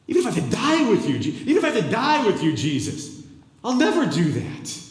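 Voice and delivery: loud, high, fast vow of loyalty; loud high insistent promise; Peter's loud protest. Acted. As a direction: shout it in Jesus' face, pleading. Peter protesting in Jesus' face.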